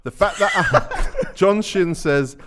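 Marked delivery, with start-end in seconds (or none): 0.0-1.3 high, loud, slow through laughter; laughing read. Real, not acted. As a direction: crack up mid-read. Reading comment, breaks laughing.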